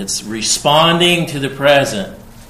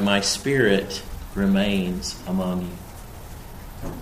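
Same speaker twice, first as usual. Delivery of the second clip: tender reassurance; 4 semitones lower, 7 dB quieter, slower than usual. Acted. Tender quote of God's promise.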